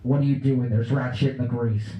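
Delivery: loud angry yell; TV chef yelling. Retold or imitated. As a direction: bark it like a furious show host. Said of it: "I instantly heard his voice".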